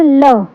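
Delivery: "ल" as an l sound with short vowel; letter sound demo. Shown as a demonstration. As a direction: sound out "ल" for learners. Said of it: "L की sound होती है".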